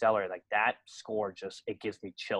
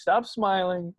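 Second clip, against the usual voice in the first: put-on drunken rant; 9 semitones higher, 5 dB louder, much slower than usual.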